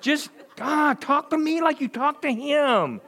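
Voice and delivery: a baffled God laughing it off; God's reply. Retold or imitated. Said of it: "he's like".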